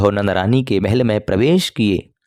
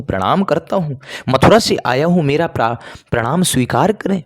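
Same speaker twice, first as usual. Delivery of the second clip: higher, calling out his greeting; raised respectful call; loud greeting; 6 semitones higher than usual. A narrator's reading. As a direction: announce yourself up to a deaf old mother. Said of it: "जैसे ही उद्धव ने जोर से प्रणाम कहा".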